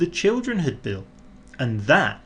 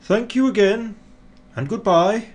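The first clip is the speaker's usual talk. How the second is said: higher, louder farewell; fond goodbye; 9 semitones higher, 4 dB louder than usual. A narrator's reading. Father Christmas bidding the snowman farewell, fondly.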